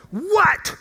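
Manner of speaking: loud, high snap into the phone; retold angry shout. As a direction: bark it like an interrupted rant. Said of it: "this time I went".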